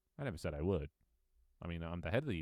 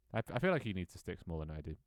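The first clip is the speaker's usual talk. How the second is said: usual speaking voice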